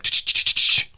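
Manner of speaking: mock scuffle noise. Imitates scuffle noise in story.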